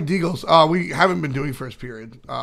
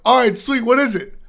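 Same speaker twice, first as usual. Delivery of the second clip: eager; 9 semitones higher, 6 dB louder than usual. Retold or imitated. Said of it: "I'm like".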